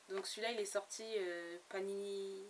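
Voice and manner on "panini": ordinary talk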